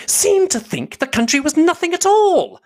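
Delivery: very high, loud outrage; high-pitched, huffy outburst; indignant matron. A narrator's reading. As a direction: complain loudly as if personally insulted. Mrs Bennet's indignant attack on Darcy.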